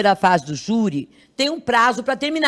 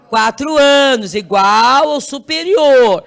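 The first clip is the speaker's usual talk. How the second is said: slow, louder, every syllable weighted; emphatic answer; 6 dB louder, slower than usual. Shown as a demonstration. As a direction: hammer the rule home for the class. Teacher stresses the key rule. (resposta enfática, lenta e mais alta)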